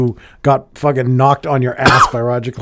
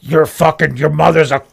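loud, raised-pitch pretend insult; mock in-your-face taunt; 4 semitones higher, 6 dB louder than usual. Goading a celebrity into a beating, mock confrontational shout. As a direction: play at provoking a fight, loudly. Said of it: "get in their face and fucking be like".

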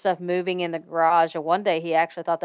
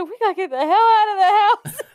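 high, loud and fast through laughter; laughing panic; 13 semitones higher, 7 dB louder, faster than usual. Retold or imitated. Urging grandma to leave the haunted house, laughing loud. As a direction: blurt it out laughing in alarm. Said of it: "I said".

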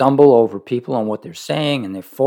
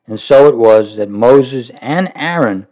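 steady measured reading of a devotional book; reading aloud; 4 dB louder than usual. Acted. Preacher reading Roy's book to listeners, measured reading voice. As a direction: read the passage evenly to the congregation.

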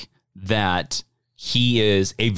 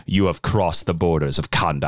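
lower, stern villain warning; 8 semitones lower than usual. Retold or imitated. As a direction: deliver it as a grave royal threat. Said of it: "And he's like".

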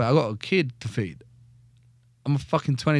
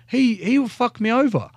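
high, loud indignant protest; angry outburst; 12 semitones higher, 5 dB louder than usual. Retold or imitated. Telling the padre he refuses his sergeant, high outraged protest. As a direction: shoot the voice right up in outrage.

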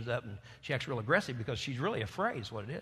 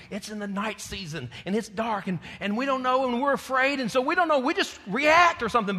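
rising higher and louder line by line; preaching build; 8 semitones higher, 6 dB louder than usual. Acted. Preacher building toward his point, growing louder and higher. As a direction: build the fear into a climax.

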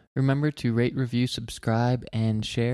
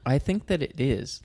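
normal talking voice

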